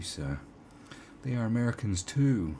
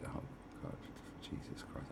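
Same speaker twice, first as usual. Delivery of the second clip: normal talking voice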